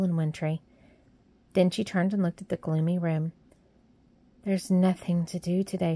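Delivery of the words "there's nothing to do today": usual speaking voice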